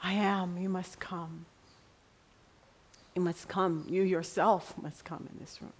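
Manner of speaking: soft, half-whispered inner plea; silent prayer. Retold or imitated. Preacher retelling her silent prayer in the classroom, softly whispered. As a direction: pray it under your breath. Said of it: "not out loud".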